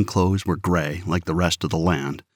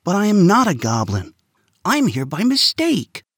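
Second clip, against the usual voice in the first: put-on higher boy, pushing back; boy retorts to the guard; retorting; 11 semitones higher than usual. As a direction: shoot back, high and insistent.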